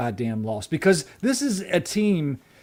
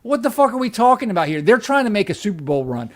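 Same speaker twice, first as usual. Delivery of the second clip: angry rant; 5 semitones higher, 4 dB louder, faster than usual. Real, not acted. Angry rant about loss.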